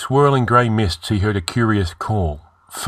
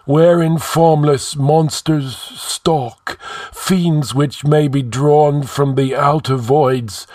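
long-dead sage intoning doom in a raised voice; sepulchral; 6 semitones higher, 4 dB louder than usual. A narrator's reading. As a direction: warn the king as an ancient spirit, measured and ringing. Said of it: "spoke in sepulchral tones".

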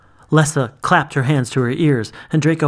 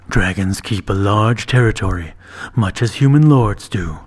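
low, lightly voiced dragon; calm, lordly dragon remark; dragon knight; 4 semitones lower than usual. Timur the dragon speaks.

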